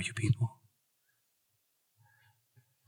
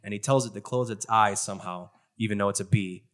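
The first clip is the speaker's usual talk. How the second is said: ordinary talk, nothing marked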